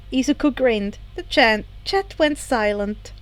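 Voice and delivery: usual speaking voice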